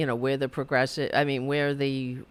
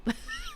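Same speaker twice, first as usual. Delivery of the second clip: squealing laugh; 6 dB quieter than usual. Real, not acted. Laughs at not getting help.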